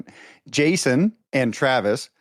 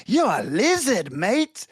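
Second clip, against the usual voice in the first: put-on a light Australian accent, higher, slow on the lizard lines; imitating Australian boss; 5 semitones higher, slower than usual. Retelling a drunk Australian boss calling him a lizard, mock accent. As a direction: mimic the drunk Aussie boss's slurred teasing. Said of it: "one of my bosses came up to me and he's like".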